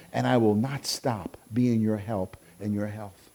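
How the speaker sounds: low, soft voice of a comforting God; tender reassurance. Acted. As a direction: gently reassure a troubled son, with pauses. Voices God reassuring a troubled son.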